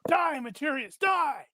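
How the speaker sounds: high-pitched acted battle cry; battle shout. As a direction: shout it as you strike to kill. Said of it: "And you shout".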